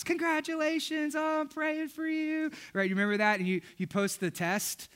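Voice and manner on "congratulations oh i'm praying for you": gushing well-wisher. Retold or imitated. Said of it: "five hundred likes".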